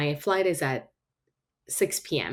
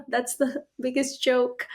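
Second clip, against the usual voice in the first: amused scoffing; 7 semitones higher than usual. Retold or imitated. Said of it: "I'm like".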